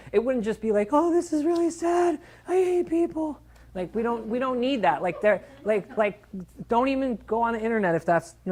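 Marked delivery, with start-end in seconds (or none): 0.9-3.4 high, mocking whine of a hand-wringing poster; hammed-up high pity voice; mock whiny post. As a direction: parody the useless sad-face post, pitched high. Mocking a sappy social media post.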